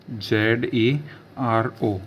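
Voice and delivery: जेड ई आर ओ spelt out slowly; slow dictation of जेड ई आर ओ; slow spelling. Shown as a demonstration. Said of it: "zero को बोलते हैं जेड ई आर ओ".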